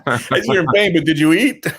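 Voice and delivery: high-pitched, amused mock concern; laughing mock question. Mother asking her injured son about eating, laughing mock concern. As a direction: ask it through a laugh.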